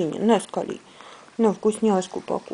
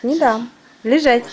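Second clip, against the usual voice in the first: ordinary delivery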